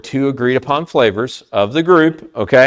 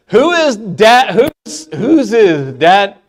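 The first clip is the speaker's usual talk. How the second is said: high, slow, stretched-out delivery; drawn-out game show question; quiz host announcement; 8 semitones higher, 5 dB louder, slower than usual. Pretend. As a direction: announce it big, word by word.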